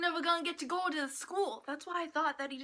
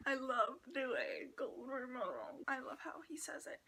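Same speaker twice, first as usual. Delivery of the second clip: whisper; 6 dB quieter than usual. Real, not acted. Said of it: "whispering aside about a voice".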